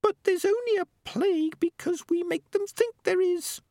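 very high squeaky little voice; tiny high-pitched creature; Peaches. A narrator's reading. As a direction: squeak an earnest objection. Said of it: "said the voice of Peaches".

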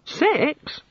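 very high, startled question; astonished echo. A narrator's reading. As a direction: echo the word as a naive, amazed god. The god echoes, astonished.

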